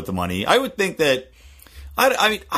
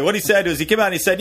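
ordinary talk, nothing marked

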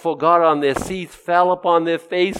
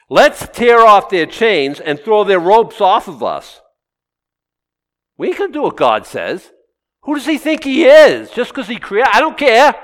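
defiant scoff; 5 semitones higher, 6 dB louder than usual. Acted. Voicing defiant rebellious nations.

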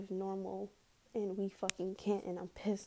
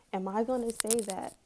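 ordinary talk, nothing marked

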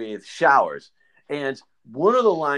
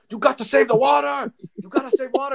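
put-on high-pitched accented scolding; Kwame; 12 semitones higher than usual. Imitating Kwame policing the shower water, high and urgent.

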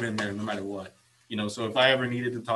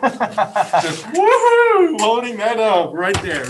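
excited cheer; 11 semitones higher, 12 dB louder, much slower than usual. Retold or imitated. Cheering about middle finger record.

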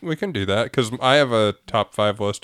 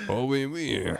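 jokey higher-pitched French "oui, oui"; teasing French accent, pitched up; mock French accent; 5 semitones higher, slower than usual. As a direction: play along in a put-on French accent. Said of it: "a French accent".

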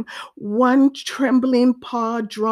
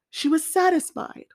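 ordinary delivery

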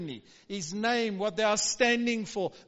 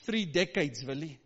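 ordinary talk, nothing marked

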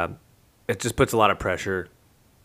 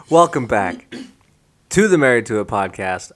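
host intro; 3 semitones higher, 8 dB louder than usual. Acted. Redoing the podcast intro.

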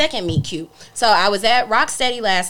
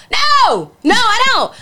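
high, loud outburst of refusal; emphatic refusal; 12 semitones higher, 6 dB louder, much slower than usual. Retold or imitated. Refusing a late-night food invite, loud high exasperation. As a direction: belt out the no, pitched way up.

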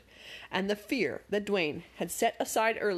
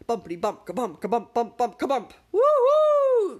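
growing louder and higher into a whoop; gleeful shouting; 4 semitones higher, 9 dB louder than usual. A narrator's reading. Dwayne loving the ride, a building gleeful shout. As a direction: chant the bumps then whoop for joy. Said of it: "Dwayne shouted louder".

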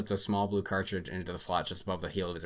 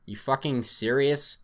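incredulous; 6 semitones higher, 6 dB louder, slower than usual. Acted. Doctor incredulous at report.

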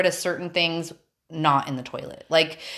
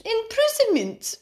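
put-on "Imprisonment" drawn out slow and much higher, English accent; playful English accent. Egged on by friend, slow high English accent. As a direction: stretch "Imprisonment" like a posh Brit, giggly. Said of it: "how my accent might twist".

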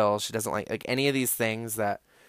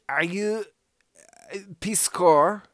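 higher, louder, slow questioning guy; imitating a foreign man; 9 semitones higher, 7 dB louder, slower than usual. The other Eastern European man asking her, in a put-on higher voice. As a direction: drawl out the question like a curious stranger. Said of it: "the other one was like".

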